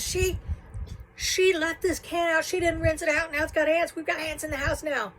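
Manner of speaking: ranting grandma. Retold or imitated. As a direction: rant to the teen about the ants. Grandma rants about ants.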